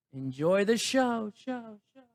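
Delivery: announcer-style send-off with trailing echo; echoing sign-off. Pretend. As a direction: stretch it out and let the last word echo away. Sign-off echoes away into show.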